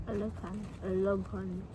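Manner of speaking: normal talking voice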